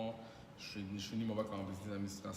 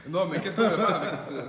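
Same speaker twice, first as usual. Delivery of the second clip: laughing; 7 semitones higher, 13 dB louder than usual. Real, not acted. Still laughing after joke.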